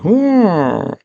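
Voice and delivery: put-on high little baby oh; baby catches the ball; baby coo. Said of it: "The baby's like".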